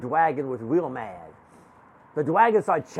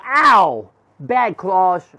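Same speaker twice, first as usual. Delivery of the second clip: pained scolding; 2 semitones higher, 8 dB louder, much slower than usual. Acted. Child scolds claws after hair pulled.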